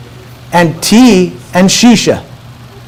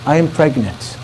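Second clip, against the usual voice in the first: soft, low admission; hushed confession; 4 semitones lower, 8 dB quieter than usual. Retold or imitated. Bride confessing to her new husband, soft and low. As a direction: retell a painful confession very quietly.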